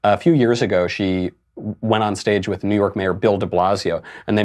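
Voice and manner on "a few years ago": usual speaking voice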